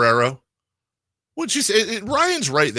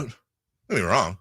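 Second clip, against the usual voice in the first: ordinary delivery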